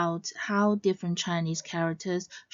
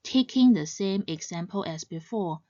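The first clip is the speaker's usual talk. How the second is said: normal talking voice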